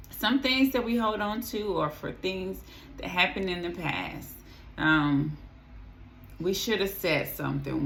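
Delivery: ordinary talk, nothing marked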